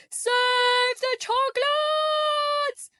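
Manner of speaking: very high, drawn-out alarmed cry; chocolate maker yelling in a panic, high and long; frantic yell. A narrator's reading. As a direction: shout it like the candy is all falling.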